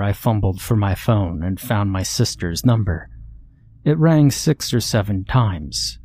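ordinary delivery, nothing marked

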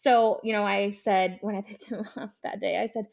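normal talking voice

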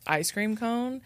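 ordinary delivery

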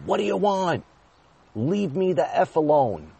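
retold yell. Father yelling at his whiny one-year-old son, high loud shout. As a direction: bark it out high like you've lost your temper. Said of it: "I yelled at him".

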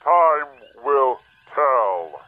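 very slow, drawn-out warning; slow ominous. Acted. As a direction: stretch each word out slowly. Oz repeats after shouting silence.

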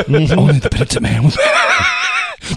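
shouted movie quote. Retold or imitated. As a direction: bellow the famous line. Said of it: "It's a movie reference".

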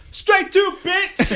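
very high, hammed-up shriek; mock furious scream. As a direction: shriek it in comic fury. Retold angry outburst at the chef.

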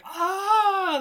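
put-on high squeal of kids gulping water; mock excited shriek. Podcaster imitating kids, a high excited squeal. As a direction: shriek like a thrilled kid.

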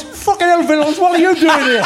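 high-pitched retelling through laughter; laughing through a quote. Retold or imitated. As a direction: quote the player while cracking up. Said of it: "and he goes".

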